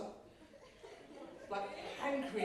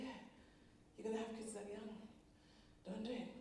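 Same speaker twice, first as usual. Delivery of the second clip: ordinary talk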